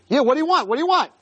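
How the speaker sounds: high-pitched eager questioning; all excited. Acted. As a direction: press eagerly for the answer. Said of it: "He was all excited".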